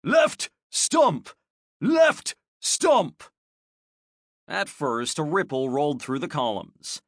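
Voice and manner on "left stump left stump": high barked drill command; shouting. A narrator's reading. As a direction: bellow it across the parade ground. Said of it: "the N C O shouted".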